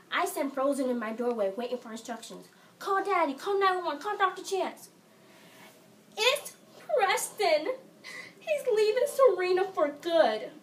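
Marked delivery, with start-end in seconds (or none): 2.8-4.9 high, loud, urgent commands; acted mother firing off orders in panic; panicked orders. Mother orders frozen daughter to phone for help, panicked. 6.2-10.5 high, loud, slow tearful wail; hysterical wailing. Acted. Sick sister wails to mother about soap opera breakup, hysterical. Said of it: "find my sister hysterical on her bed".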